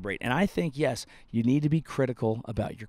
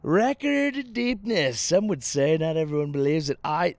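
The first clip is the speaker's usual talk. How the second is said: Trump impression; 3 semitones higher than usual. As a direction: draw out the brag like Trump. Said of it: "and he was like".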